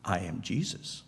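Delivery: low, hushed answer; dreadful reply. Acted. The voice in the light answers the blinded man, low and hushed. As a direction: drop low and soft, with quiet weight. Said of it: "came back the dreadful reply".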